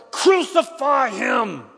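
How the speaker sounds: drawn-out, full-voiced high roar. A narrator's reading. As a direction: stretch every syllable into a huge shout. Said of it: "shouted all the louder".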